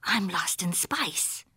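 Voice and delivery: slow, hushed, near-whispered aside; to himself. A narrator's reading. Skipito alone and lost in spice, talking to himself. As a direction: say it softly and slowly to yourself. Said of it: "Skipito said to himself".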